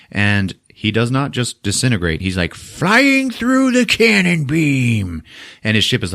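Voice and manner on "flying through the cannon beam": high, slow, drawn-out strain; dramatized straining flight. Retold or imitated. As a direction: stretch it out as if pushing through. Acts out flight through beam.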